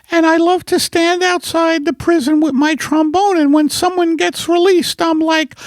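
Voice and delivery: high-pitched put-on wife voice; wife character. Wife corrects her husband about the vaccine, high character voice. Playing wife Margaret in sketch.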